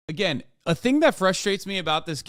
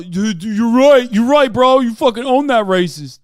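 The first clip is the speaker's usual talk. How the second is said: hype bro agreement; 5 semitones higher, 9 dB louder than usual. Retold or imitated.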